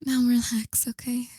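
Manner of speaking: hushed, intimate roleplay whisper, partly breathy. Acted. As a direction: murmur close to the mic like a tender lover. ASMR roleplay to a lover.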